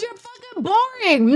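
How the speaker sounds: high sneering taunt; mocking high voice. Mocking rival streamer, high voice.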